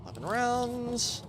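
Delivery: slow, high put-on voice; drawn-out silly voice; mock announcer voice. Reading loot, put-on voice.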